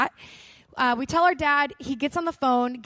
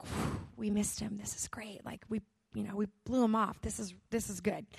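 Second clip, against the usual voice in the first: relieved hush; 4 semitones lower, 9 dB quieter than usual. Retold or imitated. Telling her sister they dodged the man, in a relieved hush. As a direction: murmur it like you just escaped someone. Relieved whisper dodging the guy.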